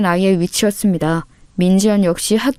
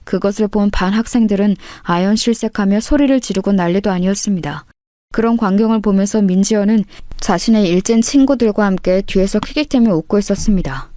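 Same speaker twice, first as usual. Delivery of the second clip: ordinary delivery, nothing marked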